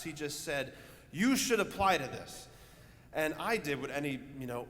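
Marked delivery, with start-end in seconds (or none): 1.1-2.5 all-caps urging. Retold or imitated. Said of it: "and in all caps".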